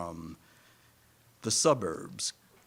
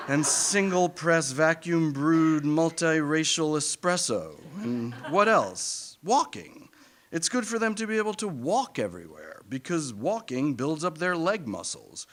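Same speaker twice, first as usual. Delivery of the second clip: higher, eager selling of city life; mock enthusiastic rationalizing; 6 semitones higher, 4 dB louder than usual. Parents talking themselves into city life, higher and keen. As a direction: pitch it higher like a proud parent. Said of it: "This is what we tell ourselves".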